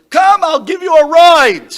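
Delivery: loud high coaxing call; pleading offer. Retold or imitated. Young rabbi urging the old sage to ride, loud. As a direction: urge him eagerly and loudly. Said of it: "Chacham, you don't need the bicycle".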